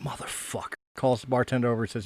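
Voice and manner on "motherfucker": very soft, voiceless swear; muttered curse. Acted. Man wiping spit off his face, whispered curse. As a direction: curse under your breath. Man spat on a second time.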